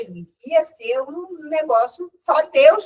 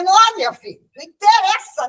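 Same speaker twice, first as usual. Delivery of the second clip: raised, higher, louder insistence; insistent retort; 5 semitones higher, 6 dB louder than usual. Retold or imitated. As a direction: push back at the driver, firm and louder. Argues with bus driver, insisting. (retrucando mais alto e mais agudo, com insistência)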